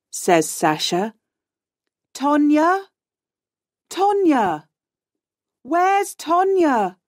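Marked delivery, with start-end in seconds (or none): 2.1-7.0 high, searching calls; alarmed shout. A narrator's reading. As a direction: call out for a missing friend, high-pitched. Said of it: "shouts Jack".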